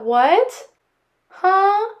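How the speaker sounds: high disbelieving what, huh; shocked squeak; surprised. Real, not acted. Shocked reaction.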